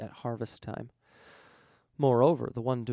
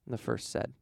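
ordinary delivery, nothing marked

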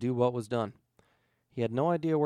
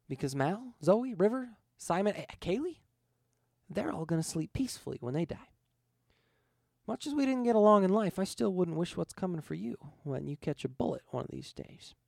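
higher, solemn warning; ominous ghost; 6 semitones higher than usual. Acted. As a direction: warn him of what awaits. Ghost warns Jayne.

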